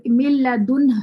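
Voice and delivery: مل لدن recited loud and slow, the ن held; slow, full-voiced recitation of مل لدن; Quranic recitation. Shown as a demonstration. Teacher recites the example aloud for the students. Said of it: "ہونٹ گول کر دیے".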